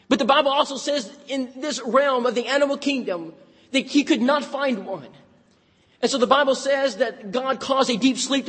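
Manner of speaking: Southern preacher in full flow; preaching. Acted. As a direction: preach it out to the church. Preaching scripture.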